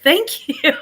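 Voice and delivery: loud, high laughing thanks. Real, not acted. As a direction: laugh through your thanks.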